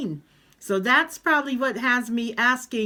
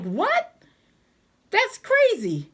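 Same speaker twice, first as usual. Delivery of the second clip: higher, stunned what, then slower; can't believe the accusation; incredulous; 9 semitones higher, slower than usual. Retold or imitated. Answering the manager's accusation, high incredulous protest.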